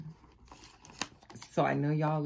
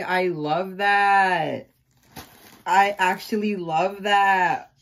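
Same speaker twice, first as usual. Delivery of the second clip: drawn-out delight; 3 semitones higher, 8 dB louder, much slower than usual. Real, not acted. Loving the gift.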